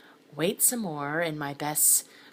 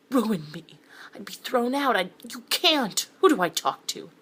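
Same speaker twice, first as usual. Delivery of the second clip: panicky pleading; 6 semitones higher, 7 dB louder than usual. Acted.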